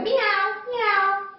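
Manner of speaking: high-pitched imitated cat meows. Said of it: "I can hear somebody going".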